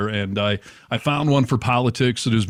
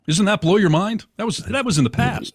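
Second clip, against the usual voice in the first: mock amazement; 10 semitones higher than usual. Mind-blown Star Wars revelation.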